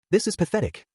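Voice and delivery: high, shaky defiance; shaky voice, forcing the words out. A narrator's reading. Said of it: "his voice shaky".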